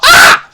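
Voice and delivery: sudden very high, loud owl shriek; barn owl screech. Pretend. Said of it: "I just wanted to say that again".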